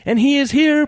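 high, loud shrimp heralding; proclaiming. A narrator's reading. Another shrimp heralds the fish to Ed, proclaiming. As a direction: proclaim it as a tiny prophet. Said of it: "proclaimed yet another".

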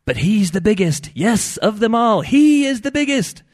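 high, loud, drawn-out shrimp proclamation; proclaiming. A narrator's reading. Shrimp herald the biggest fish to Ed, proclaiming. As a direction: proclaim it like a tiny prophet. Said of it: "proclaimed one more".

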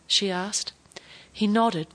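ordinary delivery

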